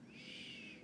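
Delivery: a long gentle shh; soft shushing. Acted. Librarian hushing the children, soft shush. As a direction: hush the room softly. Hushing kids for sleeping animals.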